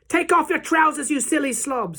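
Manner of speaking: high, loud call across the room; exasperated high-pitched yell; shouted order. A narrator's reading. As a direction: shout scornfully at a panicking workmate. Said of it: "someone else shouted".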